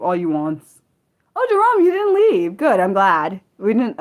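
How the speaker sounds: normal talking voice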